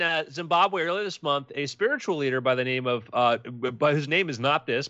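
ordinary talk

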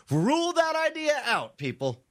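sudden high squeaky pronouncement; high mock announcement. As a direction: jump way up on the first words, drop at the end. Mock announcement ending the quote.

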